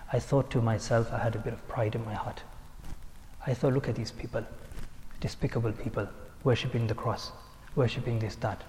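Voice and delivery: low, soft retold inner thought; hushed confession. Shaykh confessing his pride to followers, hushed.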